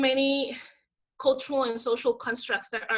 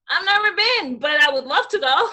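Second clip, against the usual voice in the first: higher, louder breezy retort; mock cheerful comeback; 5 semitones higher, 6 dB louder than usual. To people telling her go back to Africa, mock cheerful. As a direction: answer an insult with sunny sarcasm. Retorting to racist taunt in Moscow.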